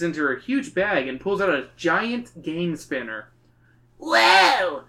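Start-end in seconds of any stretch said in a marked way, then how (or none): 4.0-4.8 put-on high, amazed little-kid exclamation; hammed-up little-brother wonder; exclaiming. A narrator's reading. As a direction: let out a high drawn-out whoa as a silly kid. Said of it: "Stinko exclaims".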